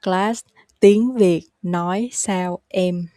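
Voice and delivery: slow, word by word; slow repeat-after-me pace. Shown as a demonstration. Teacher has learners repeat the question, slowly. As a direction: leave small gaps between the words. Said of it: "You can repeat after me".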